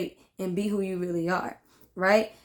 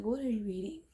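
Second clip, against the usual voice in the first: quiet aside; 10 dB quieter, faster than usual. Real, not acted. Asks Pooh off-mic, quiet.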